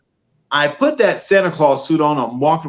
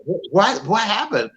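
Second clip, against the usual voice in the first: retold startled reaction, pitched up; startled question; 7 semitones higher than usual. Buddy asking about the slammed door, imitated startled question. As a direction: ask it high, stumbling on the first word.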